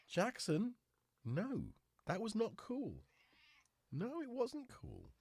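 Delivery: firm but fond no; scolding the cat. Real, not acted. Owner telling Jackson off, high and slow.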